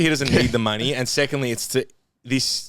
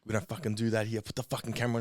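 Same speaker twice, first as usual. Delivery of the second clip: ordinary talk, nothing marked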